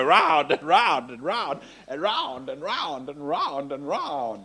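drawn-out, slow looping chant; endlessly circling. Retold or imitated. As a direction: stretch each "round", trailing off like a spinning thought. Mimics a thought going round endlessly.

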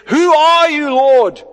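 loud, high cry of Paul; loud startled cry to the Lord. Acted. Paul knocked off donkey crying out.

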